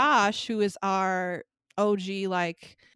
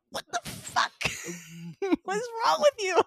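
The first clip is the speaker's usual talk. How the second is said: high-pitched incredulous; 12 semitones higher, faster than usual. Retold or imitated. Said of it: "And I'm like".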